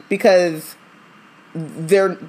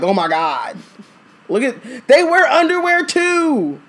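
mock gawking tourist; 7 semitones higher than usual.

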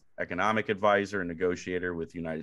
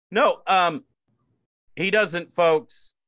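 loud protest; 11 semitones higher, 7 dB louder, much slower than usual. Acted. Mock outburst defending guest.

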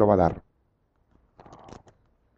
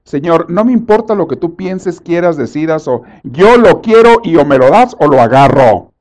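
defiant demand; 4 semitones higher, 7 dB louder than usual. Retold or imitated. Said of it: "saben qué hace la gente".

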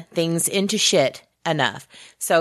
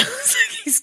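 ordinary delivery

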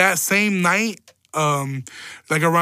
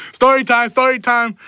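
announcing story time; 6 semitones higher, 6 dB louder than usual. Acted.

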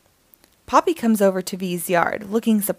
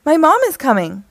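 louder, higher urgent warning; alarmed cry; 6 semitones higher, 7 dB louder, faster than usual. Acted. Poppy warns friends her mom is coming, alarmed shout. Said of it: "Poppy cries".

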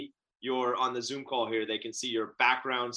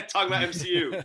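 laughing; 4 semitones higher than usual. Real, not acted. Host laughs at own pun.

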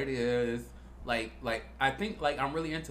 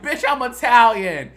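high, loud, laughing comeback; laughing retort; 8 semitones higher, 11 dB louder than usual. Retold or imitated. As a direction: fire back with a laugh. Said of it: "I was like".

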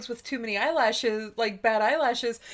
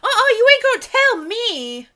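high, loud, sassy mock attitude; a much higher and louder mocking sass; 10 semitones higher, 9 dB louder than usual. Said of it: "one of them's just like".

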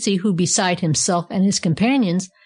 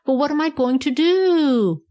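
excited shriek; 7 semitones higher than usual. A narrator's reading. Monkey left behind in the snow, excitedly shrieking. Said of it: "shrieked the monkey excitedly".

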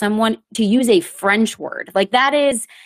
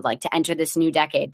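ordinary delivery

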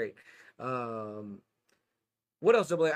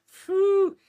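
impressed whistling exhale; 10 dB louder than usual. Real, not acted. Reacting to badass Extraction movies.

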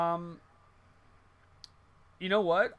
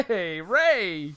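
calling out; 4 semitones higher, 9 dB louder than usual. Acted. Calling out to Ray Romano.

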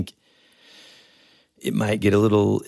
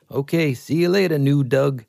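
higher-pitched movie character imitation; imitated rock guy; 7 semitones higher than usual. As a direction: lift the voice into a quirky sidekick impression. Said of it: "he's the rock guy".

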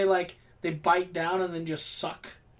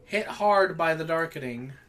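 normal talking voice

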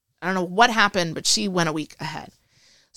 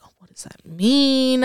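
curious surprise; 6 semitones higher, 4 dB louder than usual. Retold or imitated. Retold curious reaction.

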